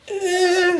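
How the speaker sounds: high, whining hesitant eh; 高音哀叫般的犹豫; whimper. Retold or imitated. His hesitant reaction retold.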